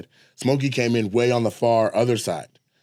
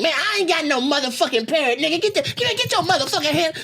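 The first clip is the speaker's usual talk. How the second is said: much higher put-on crackhead voice, indignant; crackhead denying. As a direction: swear you don't have the parrot while it squirms. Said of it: "he's making bird sounds and saying".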